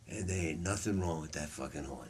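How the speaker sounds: low, soft, offhand quoted reassurance; understated verdict. Retold or imitated. Bird handing back Phil's alto, quietly understated. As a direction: drop it low and quiet, almost thrown away.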